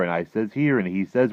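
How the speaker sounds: loud, animated storytelling; dream label read aloud. A narrator's reading.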